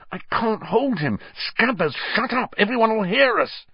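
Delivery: high, strained panic; panicky, rising voice; panicked. A narrator's reading. Ron panicking.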